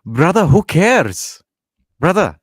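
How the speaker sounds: loud, slow, drawn-out put-on voice; big, loud imitation of a fighter; Khabib impression. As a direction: punch it out slowly in a mock fighter's voice. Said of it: "the Khabib voice".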